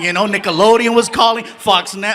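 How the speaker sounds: normal talking voice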